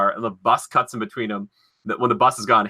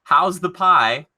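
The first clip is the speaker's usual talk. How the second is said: slow, higher, louder quote; acted movie line; 7 semitones higher, 5 dB louder, much slower than usual. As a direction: play the aunt asking kindly.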